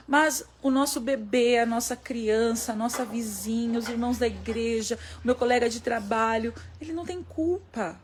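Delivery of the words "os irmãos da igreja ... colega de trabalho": ordinary delivery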